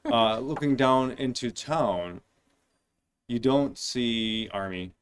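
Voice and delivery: slow, weighty scene setting; slow dramatic narration. Acted. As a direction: lay out the scene slowly. GM describing scene slowly.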